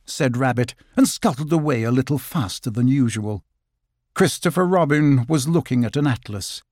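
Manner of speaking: usual speaking voice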